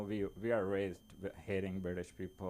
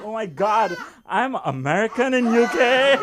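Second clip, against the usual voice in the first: loud, high burst of joy; thrilled exclamation; 12 semitones higher, 15 dB louder than usual. Retold or imitated. Comic reliving his joy at being called American, loud delight. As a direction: cry it out, giddy with pride. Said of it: "I was like".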